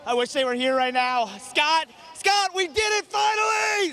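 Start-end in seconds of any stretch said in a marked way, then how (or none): 1.4-3.9 high, elated victory cry; real excitement. Real, not acted. Winner crying out to his backer, thrilled. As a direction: call out in triumph, drawing out the end. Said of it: "We love your excitement".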